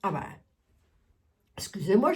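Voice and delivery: throat still catching after choking; apologize, still recovering from a cough; swallowed wrong way. Real, not acted. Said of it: "j'ai avalé de travers". (a avalé de travers)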